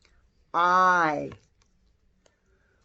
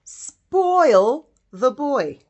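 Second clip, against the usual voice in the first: put-on "Spoil the boy" very slowly, "Spoil" stressed and louder; slow emphatic reading; 3 semitones higher, 6 dB louder, much slower than usual. Shown as a demonstration. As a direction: stretch out "Spoil the boy", hitting "Spoil". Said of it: "you would say".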